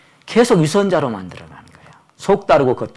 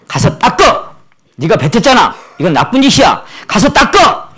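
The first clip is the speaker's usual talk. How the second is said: higher, louder scolding grandpa; stern scolding; 6 semitones higher, 6 dB louder than usual. Retold or imitated. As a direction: scold the child to go wipe it.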